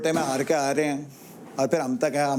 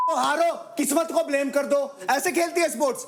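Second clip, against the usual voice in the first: high-pitched, scornful telling-off; angry reproach; 8 semitones higher than usual. Real, not acted. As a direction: argue heatedly, pitched well above normal. Angry quarrel, blaming her for losing.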